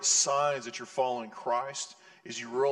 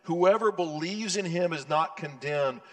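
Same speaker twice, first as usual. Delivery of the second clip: scripture reading; 2 semitones higher, 4 dB louder than usual. Acted. Reads scripture in full voice.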